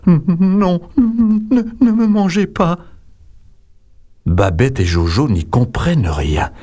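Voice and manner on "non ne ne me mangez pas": high, stammering tiny voice; a huge ogre squeaking like a scared mouse; timid ogre begging the children from the stepladder in a tiny voice. A narrator's reading. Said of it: "il dit d'une toute petite voix".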